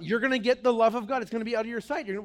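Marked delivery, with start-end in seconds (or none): none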